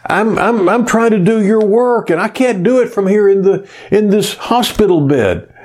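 high, whiny complaint to God; retold bedside griping, voice up high. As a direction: gripe as if wronged. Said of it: "I lay there griping at God".

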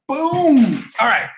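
high, loud, drawn-out boom; booming exclamation. Real, not acted. Host jacking the chair up, booming exclamation. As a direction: punch it out like a sound effect.

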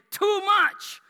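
high-pitched preacher's exclamation; exuberant emphasis. Real, not acted. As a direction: hurl it out high to the room. Climax of a list of synonyms.

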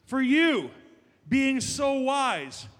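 slow, loud and dripping with sarcasm on so wise; sarcastic. Shown as a demonstration. Pastor reading Paul's line to the congregation, heavy sarcasm. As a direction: lean hard into the irony of being so wise. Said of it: "Just hear the sarcasm".

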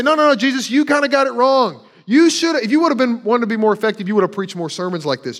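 hammed-up lecturing of Jesus; mock correction. Preacher mocks Christians correcting Jesus, loud mock scolding.

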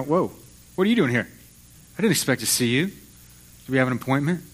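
God pretending he never expected Elijah; mock surprise. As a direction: play God caught off guard, half joking. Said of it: "It's not like God is, you know".

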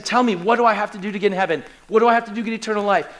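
rapid-fire desperate question; acted desperation, words rushed; desperate asking. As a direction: fire the question out urgently, pleading. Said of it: "because this desperation, he's asking".

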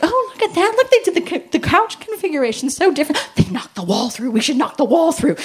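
high, loud, gushing over a neighbour's house; gushing excitement. Retold or imitated. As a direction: squeal with delight at the view. Mock excited window gazing.

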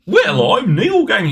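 high, slow mock British accent; bad Neil Gaiman accent. Imitating podcasters playing the author, bad British accent. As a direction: overblown fake British introduction. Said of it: "just sometimes they're like".

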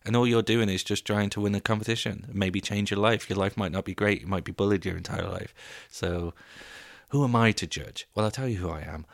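ordinary talk, nothing marked

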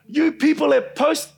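high, loud mock tirade with drawn-out words; imitated angry yelling. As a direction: imitate an irate official yelling. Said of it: "he's yelling at the top of his voice".

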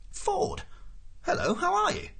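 high, surprised hello; startled greeting. A narrator's reading. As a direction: greet an old friend in high-pitched surprise. Startled Arthur greets Ford.

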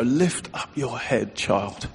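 quiet fatherly reassurance; God's tender voice. Retold or imitated. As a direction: lift them gently with the words. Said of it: "some of you need to hear this".

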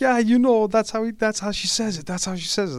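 high, excited imitated reply; acted-out amused insistence; delighted agreement. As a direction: pitch it way up, eagerly confirming the impression. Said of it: "he would just laugh and laugh".

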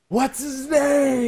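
high, loud, drawn-out heckle; screamed demand. Retold or imitated. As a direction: scream it from the crowd, stretching the words. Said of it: "I just screamed".

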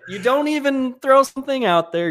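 high-pitched, exasperated mock rant; hammed-up indignant complaint; mock scolding. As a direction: playfully berate a friend, pitch climbing. Mock scolding friend over promotion.

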